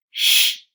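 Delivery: long loud hissed sh; shushing sound. Shown as a demonstration. Teacher isolates the SH sound for the class. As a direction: hiss the sh out loud. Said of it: "S and H, like".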